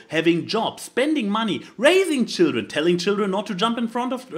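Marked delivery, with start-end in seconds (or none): none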